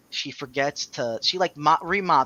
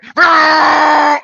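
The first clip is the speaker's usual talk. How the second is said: long, very loud high honk; imitated truck horn; 11 semitones higher, 16 dB louder than usual. Storyteller imitating the horn that woke him, loud sustained blare. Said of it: "trucker horn right next to my ear".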